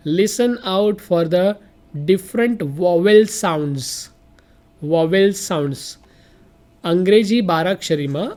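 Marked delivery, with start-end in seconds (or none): 0.1-5.8 slow instruction. Shown as a demonstration. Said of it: "teacher slow reading".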